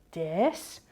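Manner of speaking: "Dis" alone, low and soft; single syllable. Shown as a demonstration. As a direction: say "Dis" as one isolated syllable, quietly. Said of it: "What was the first syllable?".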